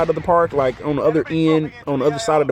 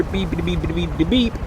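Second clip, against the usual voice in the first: spoken censor beeps; 4 dB quieter than usual. Shown as a demonstration. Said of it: "self-censoring the insult".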